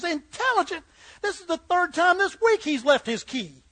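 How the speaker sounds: high-pitched, incredulous outburst; exasperated retort. Acted. Dog's owner answering the butcher, exasperated. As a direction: throw it back in exasperation.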